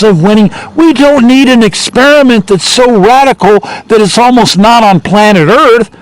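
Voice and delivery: high-pitched, exasperated complaint; worked-up voter, pitched well up; imitated pissed-off voter. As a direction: plead the case in mock exasperation. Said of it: "I mean, they're pissed off".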